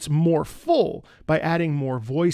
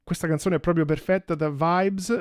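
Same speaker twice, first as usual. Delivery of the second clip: usual speaking voice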